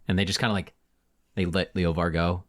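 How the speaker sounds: normal talking voice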